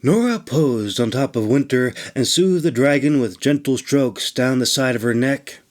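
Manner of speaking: ordinary delivery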